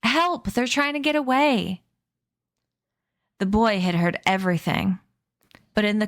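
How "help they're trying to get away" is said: high panicked shout for help. A narrator's reading. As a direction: yell out to the whole camp. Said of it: "stood up and shouted".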